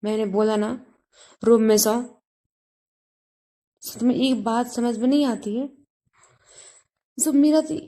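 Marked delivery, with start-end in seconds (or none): 0.0-2.0 yelled at Meera, sharp bursts; shouting. A narrator's reading. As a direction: shout her name, order her out. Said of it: "चिल्लाते हुए बोलता". (चिल्लाते हुए)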